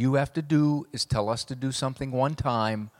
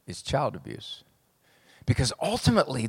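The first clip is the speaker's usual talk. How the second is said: ordinary talk, nothing marked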